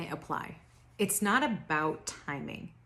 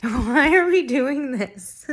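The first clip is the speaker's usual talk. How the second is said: loud, high, slow laughing question; laughing loudly; 8 semitones higher, 13 dB louder, slower than usual. Real, not acted. Laughing at her own makeup to viewers, loud laughter. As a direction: laugh through the words.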